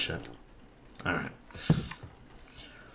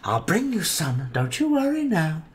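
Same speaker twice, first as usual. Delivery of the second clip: 1 loving mother; 6 semitones higher than usual. Acted. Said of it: "replied his loving, caring mother".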